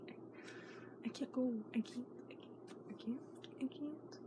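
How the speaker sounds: soft frightened whisper; scared whisper. Real, not acted. Girl hiding her fear of going to school. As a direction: whisper your fear like you might cry. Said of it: "I'm scared".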